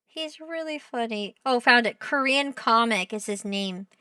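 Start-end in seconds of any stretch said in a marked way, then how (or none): none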